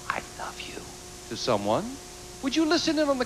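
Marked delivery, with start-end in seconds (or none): 0.1-0.8 soft whispered quote. Retold or imitated. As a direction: breathe it as a secret. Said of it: "overheard your husband whisper".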